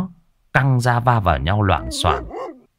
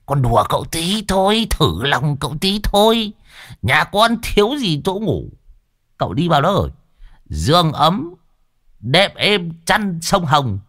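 put-on high, teasing coax to an old uncle; coaxing young man; 8 semitones higher than usual. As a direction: sweet-talk the old man, higher and playful.

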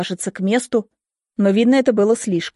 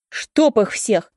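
drawn-out curse, held in volume; understated angry outburst; angry cry; much slower than usual. A narrator's reading. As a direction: curse them slowly in frustration. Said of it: "кричу и сбрасываю бокал".

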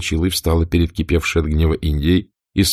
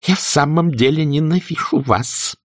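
trembling hatred; 9 semitones higher than usual. A narrator's reading. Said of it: "отчётливо, хотя и дрожащим голосом".

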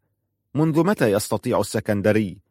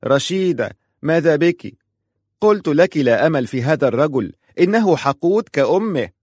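higher, louder, anxious mother; mother's worried scolding; 6 semitones higher, 4 dB louder than usual. A narrator's reading. Mother to tearful Rashida, anxious and scolding. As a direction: rush to her daughter, upset. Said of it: "قالت أمها".

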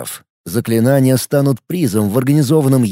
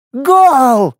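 madly laughing; 12 semitones higher, 5 dB louder than usual. Acted. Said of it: "безумно захохотала Лика".

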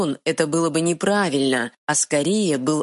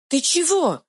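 very high alarmed fairy squeak; startled little fairy; frightened pixie; 12 semitones higher than usual. Acted. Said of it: "испуганно спросила фея".

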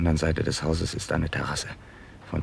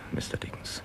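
hushed warning; whisper; 8 dB quieter than usual. Acted. Nutting sending Dickens home, whispered. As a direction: keep it barely audible. Hiding, watching the house.

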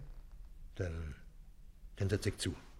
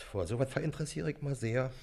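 ordinary talk, nothing marked

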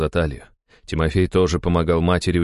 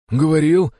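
high, raised bewildered question; bewildered muttering; 11 semitones higher, 6 dB louder than usual. A narrator's reading. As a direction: echo the word in confusion. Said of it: "пробормотал он". (растерянно пробормотать)

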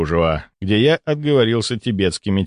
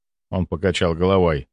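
ordinary delivery, nothing marked

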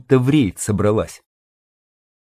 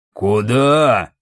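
booming bass. A narrator's reading. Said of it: "пробасил Квас".

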